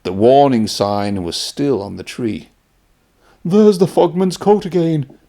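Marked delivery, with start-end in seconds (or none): 3.4-5.2 higher, louder startled cry; alarmed gasp. Acted. Henry spotting the ghost's coat again, loud startled cry. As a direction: gasp it out at the sight. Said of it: "Henry gasped".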